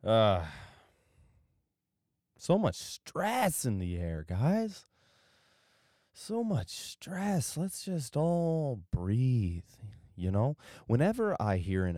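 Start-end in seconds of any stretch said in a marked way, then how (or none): 2.4-9.6 soothing slow voice. Shown as a demonstration. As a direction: ease everyone into a breath, slowly. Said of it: "calming listeners, slow breathing cue".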